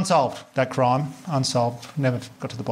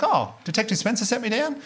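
surprised question; 8 semitones higher than usual. Retold or imitated. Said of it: "I was like".

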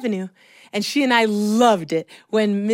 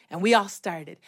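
ordinary delivery, nothing marked

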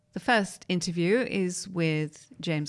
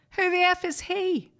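much higher, louder, hammed-up indignant squawk; mock outraged outburst; 13 semitones higher, 4 dB louder than usual. Director storming off at the actor, mock outrage. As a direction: fake an offended boss snapping off.

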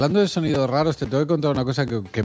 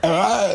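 sudden high gulping yelp; exaggerated hiccup noise; imitated hiccup; 6 semitones higher than usual. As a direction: mimic a huge hiccup from upstairs. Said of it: "de repente oyen".

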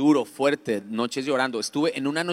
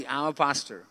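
mumbled behind a covered mouth; reluctant, smothered admission; muffled. Retold or imitated. Embarrassed man confessing his job to his doctor, muffled. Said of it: "yo me tapo la boca y yo hago así".

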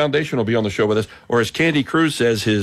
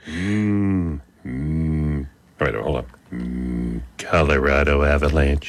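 much lower, humming into a dopey cartoon dad voice; Homer Simpson; 11 semitones lower, slower than usual. Host granting a listener request, doing a much lower cartoon dad voice. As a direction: warm up with a low Homer hum, then drawl the team name. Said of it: "in your Homer Simpson voice".

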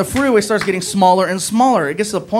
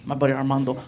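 ordinary talk

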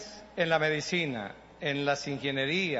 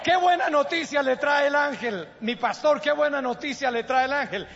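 louder, higher preaching; fervent preaching; 10 semitones higher, 7 dB louder than usual. Acted. Pastor preaches the angel's message to the church. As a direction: preach the good news with fire.

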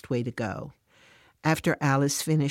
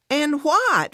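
much higher and louder, indignant; raised voice; 12 semitones higher, 5 dB louder than usual. Acted. Fran to Alice, raising her voice in indignation. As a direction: snap back at the question. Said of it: "Fran raises her voice".

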